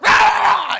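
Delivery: very high, loud mock scream; imitated angry yell. Comedian imitating a German hotel lady yelling, loud high shriek. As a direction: scream it like someone yelling across a lobby. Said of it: "she yells at this guy".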